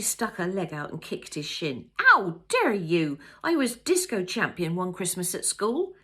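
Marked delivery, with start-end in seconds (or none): 2.0-5.9 much louder, slow indignant protest; play-hurt after a kick; mock outrage. A narrator's reading. Kicked in the shin, protesting.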